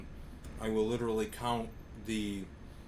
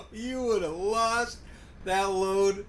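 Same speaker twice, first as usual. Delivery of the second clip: laughing; 8 semitones higher, 4 dB louder, much slower than usual. Real, not acted. Laughing at friend's crash.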